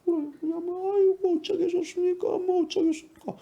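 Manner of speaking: high-pitched put-on voice of meek resignation; mock-helpless high whine; meek conservative voters. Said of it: "굉장히 착해요".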